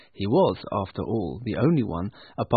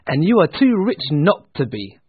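higher, louder comeback; spirited nephew; 6 semitones higher, 7 dB louder than usual. Acted. Nephew countering his uncle, spirited. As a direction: toss it back with lifted voice. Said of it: "said his nephew".

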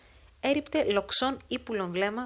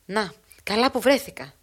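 louder, emphatic exclamation; called out; 8 dB louder than usual. Acted. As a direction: call it out to him at once. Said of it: "εφώναξε προς τούτον η Φραγκογιανού".

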